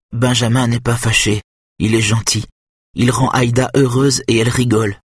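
put-on a boy defending his friend; lightly voiced simple boy. A narrator's reading. Nick insists to Kenneth that Benjamin is kind, earnest.

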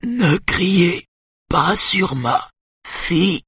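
high, drawn-out angry hiss; hissed warning. A narrator's reading. Mother defending her daughter from shouting, hissed. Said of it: "siffle la maman".

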